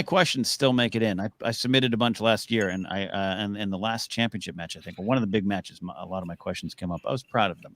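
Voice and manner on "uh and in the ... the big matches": ordinary talk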